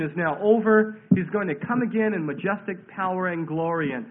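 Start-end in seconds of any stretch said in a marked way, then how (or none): none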